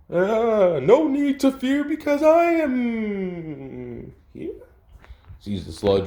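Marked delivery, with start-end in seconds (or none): 0.1-4.5 much higher, slow, shouted hero call; booming heroic proclamation. Acted. All Might arriving to save the day, booming slow shout. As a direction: announce yourself like a larger-than-life hero.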